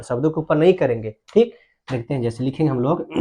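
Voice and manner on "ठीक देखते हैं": usual speaking voice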